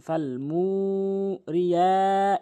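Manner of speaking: "ফাল মুরিয়া" very slow, "মু" and "য়া" held; elongated vowels. Shown as a demonstration. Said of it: "এভাবে টেনে পড়তে হবে". ("মু" আর "য়া" টেনে "ফাল মুরিয়া")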